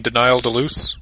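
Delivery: "Denial Deluce" said de-NYE-ul de-LOOSE; "Denial" like the word denial, "Deluce" rhyming with loose; joke mispronunciation. Pretend. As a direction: say "Denial Deluce" like a fancy misread name. Deliberate mispronunciation of Daniel Day-Lewis.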